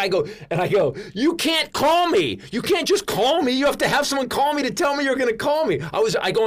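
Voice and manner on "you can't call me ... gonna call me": high, loud, overwhelmed fan protesting; flustered protest. Retold or imitated. Overwhelmed fan protesting to a star's surprise call, flustered protest. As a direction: protest in a high, panicky comic rant.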